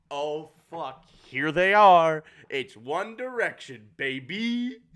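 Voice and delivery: high, loud giddy squeal into laughter; fangirl squeal. Real, not acted. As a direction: squeal like a thrilled fan. Fangirling at One Direction boys.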